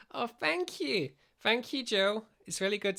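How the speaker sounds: high, touched thank you. Real, not acted. Reacting to viewer message.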